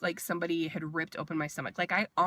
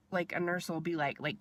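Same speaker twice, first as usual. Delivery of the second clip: ordinary talk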